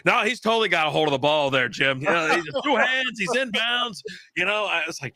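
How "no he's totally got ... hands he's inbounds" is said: mocking Romo. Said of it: "And he goes".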